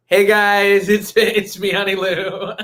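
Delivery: lightly voiced bubbly show host; mocking host voice. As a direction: tease her with a light host greeting. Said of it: "this is you".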